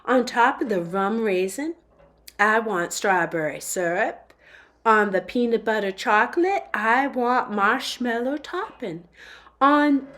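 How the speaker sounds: put-on a fussy customer in a half Southern, half posh British accent; drawn-out Southern-British accent ordering ice cream. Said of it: "in a strange accent that was half Southern drawl and half upper crust British".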